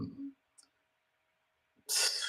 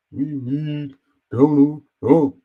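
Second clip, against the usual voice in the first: slow, higher mock half-preacher drawl; mocking Tim Scott; 6 semitones higher, slower than usual. Vlogger mocks the senator's rally speech, slow higher mock preacher voice. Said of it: "why was he talking like that".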